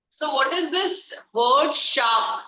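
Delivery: slow, drawn-out teacher question. Shown as a demonstration. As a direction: ask it slowly, word by word. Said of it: "teacher stresses the question slowly".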